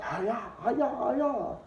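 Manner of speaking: high, soft hi-ya chops; mock karate cries. Narrator acts out guards whipping prisoners, mock karate cries. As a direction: imitate the guards' belt blows with silly cries.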